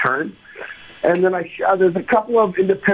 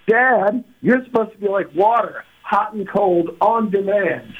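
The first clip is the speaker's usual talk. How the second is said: put-on higher, entitled teen daughter voice; 4 semitones higher than usual. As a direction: play the demanding daughter lightly.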